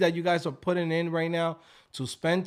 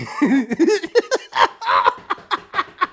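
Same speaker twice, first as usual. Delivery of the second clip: high laugh; 10 semitones higher, 6 dB louder than usual. Real, not acted. Laughing hard.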